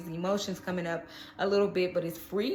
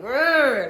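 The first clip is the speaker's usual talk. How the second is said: loud, raised "grr" of frustration; irritated growl; 4 semitones higher, 9 dB louder than usual. Acted. Reader voicing Pisces' irritation, frustrated growl. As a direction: let out an exasperated growl. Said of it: "I'm feeling irritation too".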